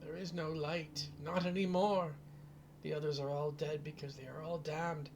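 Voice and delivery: put-on high, wailing old crone, rising to cries; sobbing old woman. Old mother warning the heroes of Strahd, high sobbing wail. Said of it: "She just sobs".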